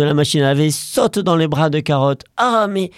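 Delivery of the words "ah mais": put-on higher, fussing girl voice; girl scolding. Girl finding the cat all dirty, fussing. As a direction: fuss over the dirty cat.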